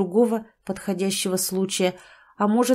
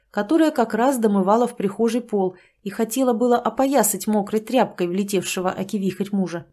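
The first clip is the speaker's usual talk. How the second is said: ordinary delivery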